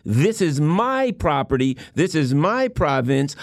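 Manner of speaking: slow, with my pressed hard; deliberate possessive emphasis, slow; stress on my. Retold or imitated. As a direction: claim it slowly, leaning on my. Voicing China's claim, slow.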